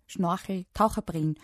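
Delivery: usual speaking voice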